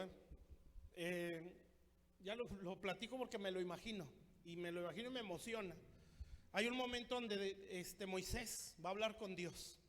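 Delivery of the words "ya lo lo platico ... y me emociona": fast, eager rush through it. Real, not acted. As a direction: hurry on, excited by the story. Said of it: "ya se lo digo así rápido".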